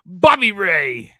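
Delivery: put-on loud, drawn-out accented call; Santino Marella impression. Imitating a wrestler's promo on Bully Ray, hammed-up accented holler. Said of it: "with the accent he has".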